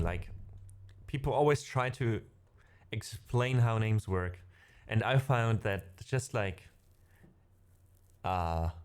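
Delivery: normal talking voice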